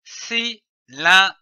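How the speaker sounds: "cylindre" broken into separate syllables with pauses; "cylindre" in detached reading-lesson syllables. Shown as a demonstration. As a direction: sound out "cylindre" one syllable at a time. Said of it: "L'association des syllabes donne le mot".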